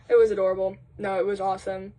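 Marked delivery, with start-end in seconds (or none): none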